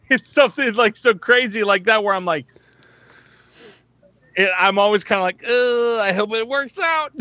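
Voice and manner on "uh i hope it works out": high, strained groan into doubtful hope; mock-anxious, stretched-out delivery; uneasy wincing. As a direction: wince through it, pitched up high. Uneasy reaction to his own work.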